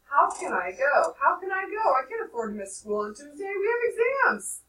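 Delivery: high, pleading rush of excuses; boy making up excuses; impetuous protest. A narrator's reading. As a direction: blurt out excuses. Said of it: "I said impetuously".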